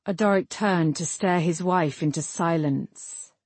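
ordinary delivery, nothing marked

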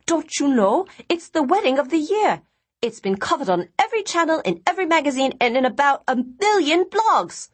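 high, breathless excitement; excited. Acted. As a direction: gush with thrilled disbelief. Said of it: "suddenly getting very excited".

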